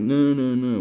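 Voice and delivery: put-on loud, high childish "No, no, no"; mock refusal. Mocking young people refusing to stay home, loud whiny no. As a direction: whine a stubborn no. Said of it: "but you're like".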